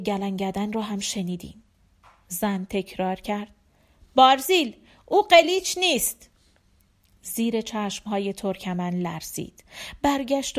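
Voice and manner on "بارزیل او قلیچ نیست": loud, higher-pitched urgent plea; urgent appeal. A narrator's reading. Woman stops armed Barzil, urgent appeal. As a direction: call out to stop him, voice raised.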